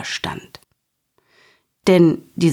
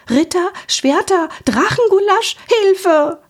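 much higher, frantic little dragon squeal; panicked cry; 14 semitones higher than usual. A narrator's reading. The frozen little dragon panicking at the knights, high frantic cry. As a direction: blurt it out in sudden panic.

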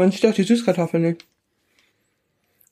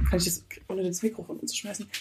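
whisper; 12 dB quieter, faster than usual. Real, not acted. Careful not to knock over microphone.

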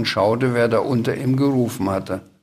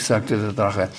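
ordinary delivery, nothing marked

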